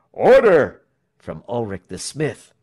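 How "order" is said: loud, high call; cry for order. A narrator's reading. Smith stopping the crowd's brawl, crying out. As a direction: shout to restore order. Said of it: "cries of".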